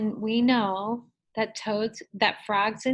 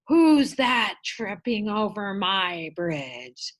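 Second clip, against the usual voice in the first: slow, louder storybook troll; 5 dB louder, slower than usual. Shown as a demonstration. Teacher showing children the Billy Goats Gruff troll, troll voice. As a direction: do a drawn-out, challenging troll for the kids. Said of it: "do the troll voice".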